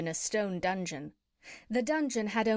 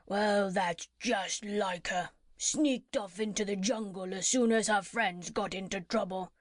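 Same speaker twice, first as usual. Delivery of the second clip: a lightly voiced crocodile, mildly scornful; grumbling crocodile. Crocodile speaks on in prison.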